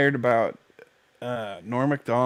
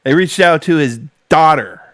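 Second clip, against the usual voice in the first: loud; 4 semitones higher, 11 dB louder than usual. Real, not acted. Outraged at the news.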